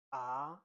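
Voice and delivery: Mandarin second tone on AH, sliding upward. Shown as a demonstration. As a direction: let AH climb like a question. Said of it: "The second one is".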